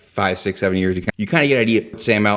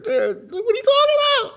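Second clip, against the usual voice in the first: incredulous. Real, not acted. Exasperated at Altman.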